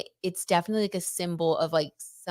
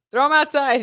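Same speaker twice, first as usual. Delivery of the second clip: lightly voiced breezy advice, higher and louder; mock quote; 8 semitones higher, 7 dB louder than usual. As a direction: toss it off like it's nothing. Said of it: "that cracked me up".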